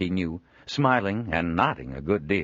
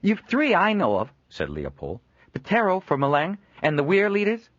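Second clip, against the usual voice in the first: Leopold pressing; 13 semitones higher, 5 dB louder than usual. Acted.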